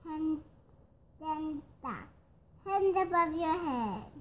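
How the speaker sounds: high storybook shout, building in volume. A narrator's reading. Police ordering a bear to surrender, shouting. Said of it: "there was a shout".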